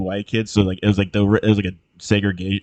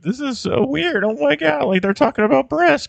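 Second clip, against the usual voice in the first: overacting kid. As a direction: overplay the flustered kid reading a racy book. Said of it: "the actor was trying too hard".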